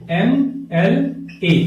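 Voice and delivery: MLA stretched very slowly, each letter set apart; drawn out slowly. Shown as a demonstration. Teacher slowly saying the word for students. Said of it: "MLA ले लो".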